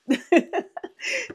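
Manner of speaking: squealing high laugh. Real, not acted. Cat does something silly.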